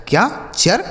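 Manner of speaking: ordinary talk, nothing marked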